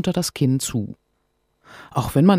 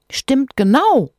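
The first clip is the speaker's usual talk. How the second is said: proud; 12 semitones higher, 7 dB louder, slower than usual. Acted. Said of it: "sagt Sophia stolz".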